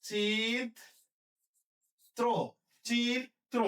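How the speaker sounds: চিত্র split as chit... tro; syllable-by-syllable demo. Shown as a demonstration. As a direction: hold চিত্র apart in two slow syllables, chit and tro. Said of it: "তাহলে হবে কি?". (চিত্র দুই ভাগে ভেঙে উচ্চারণ)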